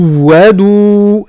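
slow measured tajweed recitation; Quran recitation. Shown as a demonstration. Teacher recites for students in slow tajweed. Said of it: "هكذا".

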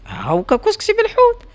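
high, sing-song wife insisting; mock-innocent wife. A narrator's reading. Wife insists during quarrel. (الزوجة تصرّ ببراءة مصطنعة بصوت عالٍ)

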